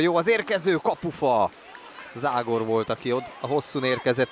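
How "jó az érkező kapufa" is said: excited commentary. Real, not acted. Near miss off the post.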